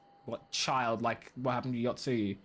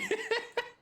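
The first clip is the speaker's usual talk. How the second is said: airy laugh pitched way up; high breathy laugh. Real, not acted. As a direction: giggle breathily. Laughing after thanking chat.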